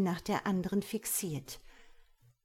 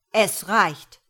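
much louder, commanding outburst; thundering; 11 dB louder than usual. A narrator's reading. God silences the gossiping angels, thundering. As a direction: thunder it to end the talk. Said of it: "donnert sie".

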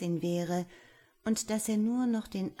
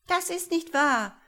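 very high, loud protest; shrieky, indignant denial; squabbling shout; 11 semitones higher, 7 dB louder than usual. A narrator's reading. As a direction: shout it down like bickering little creatures. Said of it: "riefen sie alle durcheinander".

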